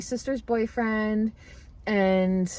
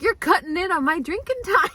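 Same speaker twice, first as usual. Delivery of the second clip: higher-pitched playful protest; mock complaint; 8 semitones higher than usual. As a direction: retell a jokey grievance, amused. Husband's jokey complaint to clerk, retold.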